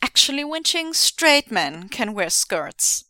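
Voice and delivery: slow, loud know-it-all; smug. Acted. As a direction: lecture her smugly.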